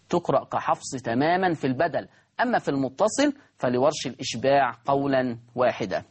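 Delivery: usual speaking voice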